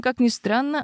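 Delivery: ordinary delivery, nothing marked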